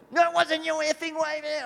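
high, loud, indignant imitation; mock angry retort. Rival surfer arguing over whose wave it was, loud mock outrage. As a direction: snap back in hammed-up outrage.

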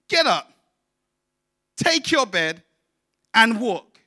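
high, loud preacher's command; preached command. Acted. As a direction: ring out Jesus's words.

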